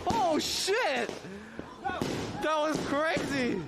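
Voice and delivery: sudden high shocked yell; startled. Real, not acted. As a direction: jump at the blast, stretch crazy out. Startled by explosion in game.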